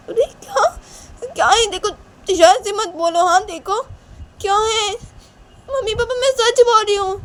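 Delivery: very high, loud, squeaky protest; pleading upset girl. Acted. Shanaya appeals to mummy and papa, upset. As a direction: appeal shrilly to be believed. Voices daughter appealing to parents.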